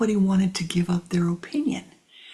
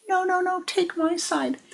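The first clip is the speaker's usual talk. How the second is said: put-on much higher childlike voice begging; little one pleading; 12 semitones higher than usual. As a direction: squeak it up like the smallest one pleading.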